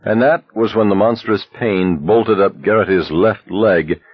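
ordinary delivery

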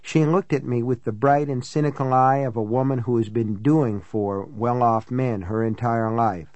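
usual speaking voice

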